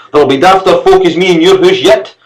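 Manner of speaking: high, loud spluttering rage; angry sputter. A narrator's reading. Servant sputtering a parting insult at the master. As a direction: spit the last insult as you go. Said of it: "sputtered Gilmer angrily".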